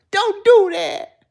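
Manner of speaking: high, loud drawn-out "don't do that"; quoting her. Retold or imitated. Telling a man trying to kiss her off, imitated high cry. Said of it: "she said".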